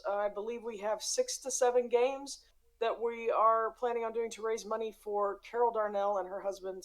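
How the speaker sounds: ordinary delivery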